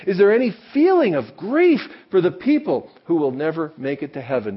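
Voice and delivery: loud, high, pressing the congregation; fervent preaching. Acted. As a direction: preach it hard, challenging every listener. Preaching at full force.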